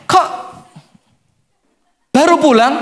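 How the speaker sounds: nagging wife. Retold or imitated. Wife greeting husband late home, a mock nagging whine. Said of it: "Dokter Aisyah Dahlan bilang apa".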